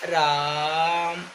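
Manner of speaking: राम drawn out, long held आ; prolonged calling. Shown as a demonstration. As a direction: read राम as a long call. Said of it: "तो इसका पढ़ते कैसे बच्चों".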